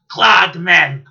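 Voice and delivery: loud barked quote; shouted catchphrase. Retold or imitated. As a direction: belt it out.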